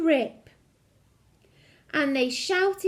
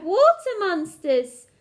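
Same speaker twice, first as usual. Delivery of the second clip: louder, slow scared cry; frightened cry; 4 semitones higher, 6 dB louder than usual. A narrator's reading. Sister seeing her reflection, slow loud fright. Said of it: "one cried".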